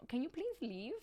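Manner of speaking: soft, put-upon plea to be left alone; softly voiced sulky Cinderella; mock teary brush-off. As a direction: shoo him away quietly, close to tears in jest. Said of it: "I could imagine this scenario".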